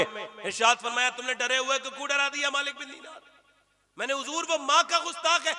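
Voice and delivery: tearful high-pitched sermon telling of Judgment Day; wailing sermon. Acted. High wailing retelling of the dream.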